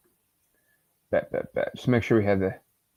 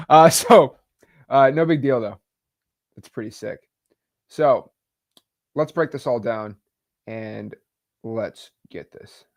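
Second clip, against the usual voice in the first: normal talking voice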